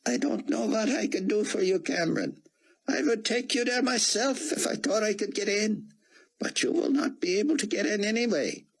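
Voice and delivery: put-on high, foreign-sounding older gentleman; old Israeli scholar. Rosenzweig voiced by the narrator.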